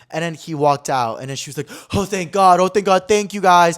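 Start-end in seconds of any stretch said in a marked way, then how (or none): 1.9-3.8 louder, gushing thanks; effusive gratitude bursting out; retold relief. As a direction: imitate someone hugely relieved. Retold girl relieved after he leaves.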